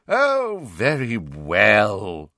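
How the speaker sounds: slow, drawn-out reluctant sigh; grudging. A narrator's reading. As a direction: give in with bad grace. Phineas gives in.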